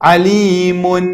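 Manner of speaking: عەلیمون drawn out, ending on a sounded n; audible tanween n. Shown as a demonstration. Teacher checking students hear the nun, عەلیمون with clear final n. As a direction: let the final n of عەلیمون ring out. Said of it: "نونتان بیست یان نەتان بیست؟".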